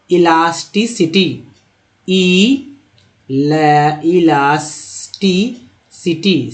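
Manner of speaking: "इलास्टिसिटी" slowly broken into i-las-ti-si-ti; slow teaching model of "इलास्टिसिटी"; syllable-by-syllable. Shown as a demonstration. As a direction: stretch out each syllable of "इलास्टिसिटी". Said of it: "सिटी तो समझ ही रहे हैं".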